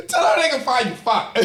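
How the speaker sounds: laughing through the words; breaking up mid-sentence. Real, not acted. Giggling fit while winded.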